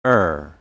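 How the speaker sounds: "er" with the R sounded; American R ending. Shown as a demonstration. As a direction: curl the tongue into a clear "er". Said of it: "we pronounce the word R".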